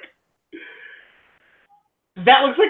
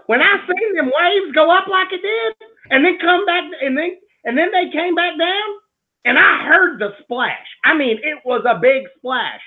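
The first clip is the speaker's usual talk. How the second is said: put-on Tennessee accent; 9 semitones higher than usual. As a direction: read it in a hammed-up Tennessee accent. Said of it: "let me read it in the accent".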